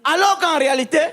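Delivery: normal talking voice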